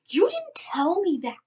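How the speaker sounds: high, loud retold protest; exasperated comeback. Passenger answering the driver afterwards, retold exasperated protest.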